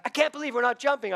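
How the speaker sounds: high, loud panicky pleading; imitating a terrified friend; panicked. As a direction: mimic a man losing his nerve. Retold disappointed son quote.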